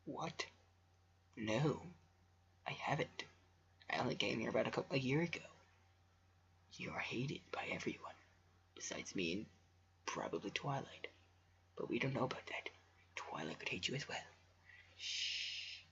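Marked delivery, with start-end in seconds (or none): 0.1-3.2 whisper. Real, not acted. Whispered aside to camera. 6.8-15.8 soft unvoiced whisper; conspiratorial hushed whisper; whispered aside. Real, not acted. Whispered aside about Celestia.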